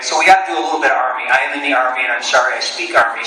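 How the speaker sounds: normal talking voice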